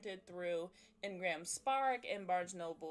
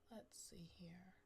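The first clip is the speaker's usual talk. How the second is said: whisper; 4 semitones lower, 17 dB quieter than usual. Real, not acted. Muttering to self while setting up.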